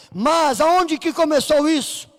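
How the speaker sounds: ordinary talk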